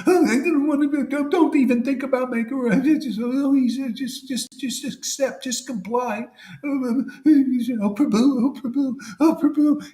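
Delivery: high, whiny mock of a timid devotee; preacher mimicking a weak disciple in a high simpering voice. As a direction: ham up a spineless follower begging his guru. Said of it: "they're so weak".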